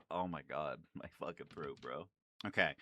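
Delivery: normal talking voice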